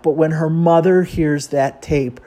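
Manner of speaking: ordinary talk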